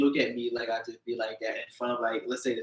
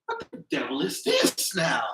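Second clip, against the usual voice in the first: mock old-timey outrage; 9 semitones higher, slower than usual. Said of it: "he turns his head and said".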